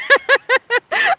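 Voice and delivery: high, bursting laugh; a giddy laugh at the idea; high, joyful laugh; delighted laugh. Real, not acted. Imagining a bonfire with fans.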